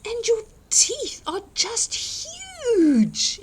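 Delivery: very high, slow astonished cry; exclaiming. Acted. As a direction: gasp at the huge teeth.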